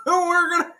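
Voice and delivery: high put-on campaign promise voice; pandering politician. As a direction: promise the moon like a school candidate. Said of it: "someone's like".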